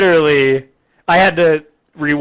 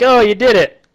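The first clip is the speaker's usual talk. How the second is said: higher, louder delighted cry; hammed-up relieved joy; mock cheering; 8 semitones higher, 4 dB louder than usual. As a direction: celebrate like the rescued crowd. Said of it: "And everybody's like".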